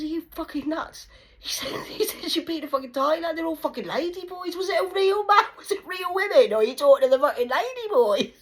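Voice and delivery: high, loud disbelieving yell; mock incredulous shout. [name] mocking his brother's Thailand trip, loud and high.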